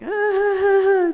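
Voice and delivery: high, loud imitated wail; mock sob. Student wailing to her teacher on the phone, mock sob. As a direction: break into a put-on sobbing cry. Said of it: "really sobbing".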